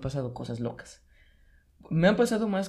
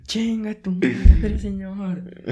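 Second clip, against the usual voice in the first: loud, very slow higher shout; retold shouted curse; 6 semitones higher, 6 dB louder, much slower than usual. Friend misunderstanding and cursing the man: slow loud shout. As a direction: drag the insult out loud.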